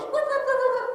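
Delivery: very high, squeaky puppy barks. Acted. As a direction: yip like a tiny puppy. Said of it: "Puppy goes".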